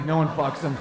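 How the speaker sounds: ordinary talk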